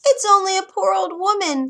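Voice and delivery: high, squeaky crone voice; squeaky old woman voice. Acted. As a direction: put on a thin squeaky old voice. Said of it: "came a squeaky voice".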